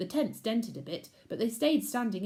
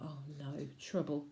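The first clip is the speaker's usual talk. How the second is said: hushed nervous thought; worried whisper; 5 semitones lower, 8 dB quieter than usual. Acted. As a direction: mutter it under your breath. Thinks nervously.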